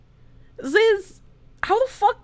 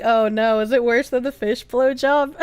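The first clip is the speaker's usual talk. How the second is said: usual speaking voice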